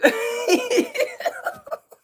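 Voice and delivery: cackling burst of laughter; loud high laugh. Real, not acted. Laugh after joke.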